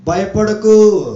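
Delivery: loud exhortation. Real, not acted. Preacher urging the church not to fear, loud exhortation. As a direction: call it out with a swelling ending. Same comfort called out.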